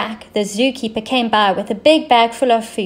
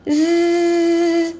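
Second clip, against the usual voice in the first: imitated buzz, pitched up; buzzing sound; 7 semitones higher than usual. The noise interrupting her doze again, a high buzz. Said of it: "but zzz!".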